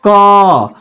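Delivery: "क" held long on its vowel; drawn-out pronunciation. Shown as a demonstration. Teacher showing students how the consonant is pronounced, drawn out "क". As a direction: sound out "क" slowly, stretching the vowel. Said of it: "क्या होता है?".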